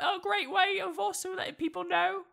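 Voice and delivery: high mock cockney urchin; terrible cockney accent. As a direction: sell the podcast like a cheeky London orphan. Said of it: "my terrible accent".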